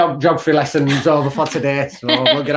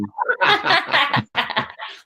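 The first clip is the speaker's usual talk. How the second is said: high burst of laughter. Real, not acted. Friend laughs at the dad's joke about lockdown, high laughter.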